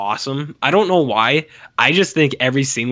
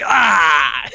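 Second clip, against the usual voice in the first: short loud shove cry; effort grunt; 3 semitones higher, 4 dB louder than usual. Retold or imitated. King throwing the man out, short shouted heave. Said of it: "he's like".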